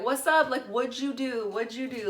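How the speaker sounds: high-pitched, retold cheeky questioning; nosy chatting-up. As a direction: pester strangers with breezy questions. Chatting up other inmates at jail.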